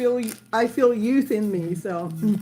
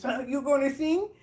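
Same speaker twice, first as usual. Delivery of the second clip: ordinary delivery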